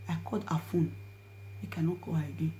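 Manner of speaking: ordinary delivery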